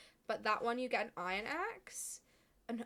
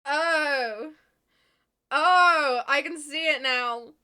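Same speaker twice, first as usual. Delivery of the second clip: loud realization; 3 semitones higher, 13 dB louder, much slower than usual. Real, not acted. Sudden realization reading chat.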